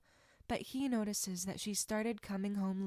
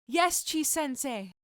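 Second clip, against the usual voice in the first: drawn-out, louder answer in unison; student chorus; 5 semitones higher, 6 dB louder, slower than usual. Acted. Students answering their sensei together, slow and loud. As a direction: call it back as a class. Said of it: "There is a chorus of student voices".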